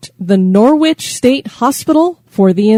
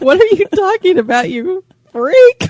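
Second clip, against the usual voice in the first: mock exasperated; 9 semitones higher than usual. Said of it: "I'm like".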